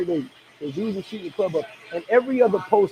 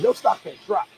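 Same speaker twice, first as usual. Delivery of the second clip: ordinary talk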